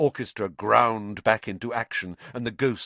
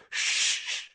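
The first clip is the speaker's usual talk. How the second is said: hush. A narrator's reading. Hushing friends.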